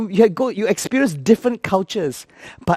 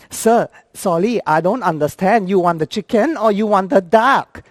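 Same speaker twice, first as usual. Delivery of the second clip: confused Thai attendant; 4 semitones higher than usual. Retold or imitated. Confused attendant asking boss to choose, puzzled Thai accent. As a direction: apologise in halting Thai-accented English. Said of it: "she was so confused".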